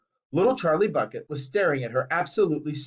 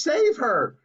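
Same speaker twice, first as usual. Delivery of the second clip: high plea; desperate cry; 8 semitones higher than usual. A narrator's reading. Mother crying out to save her daughter, high. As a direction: beg for help. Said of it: "cried Missus Beauregard".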